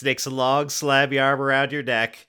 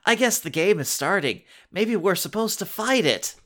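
excited; 8 semitones higher than usual. A narrator's reading. Jordan eager in story.

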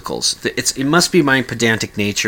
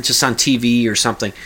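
usual speaking voice

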